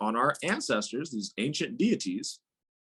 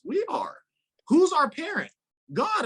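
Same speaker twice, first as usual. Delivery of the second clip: emphatic high; 3 dB louder than usual. Real, not acted. Rhetorical emphatic questions.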